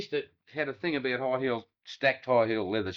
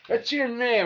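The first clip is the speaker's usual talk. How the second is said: indignant retort; 10 semitones higher, 5 dB louder, slower than usual. Retold or imitated. Charlie answering the conductor.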